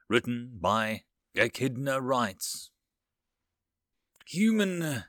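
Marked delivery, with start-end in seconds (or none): 4.3-5.0 exasperated, higher-pitched address with a falling end. A narrator's reading. Alien counselor addressing the human, exasperated. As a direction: sigh the word out in exasperation. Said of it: "the clicking voice ... was heard with exasperation".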